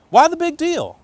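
high, loud mock puzzlement; rhetorical challenge. Real, not acted. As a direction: toss the question out to the room. Rhetorical question to congregation.

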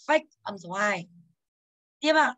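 normal talking voice

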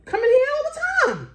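high, fast squeal of wonder; marveling at the place; amazed. Retold or imitated. Arriving at the familiar school, squealing high and fast.

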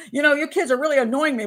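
mock-annoyed parent telling off children; annoyed American parent. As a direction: scold the kids in everyday American. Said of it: "As an American, I would go".

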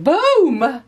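theatrical burst of excitement; dramatic boom. Real, not acted. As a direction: land it like an explosion. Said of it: "I speak like that".

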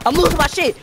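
high-pitched frantic outburst; freaking out. Real, not acted. Gamer losing it mid-match, freaking out. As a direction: voice leaps up, losing control. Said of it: "I'm freaking out right now".

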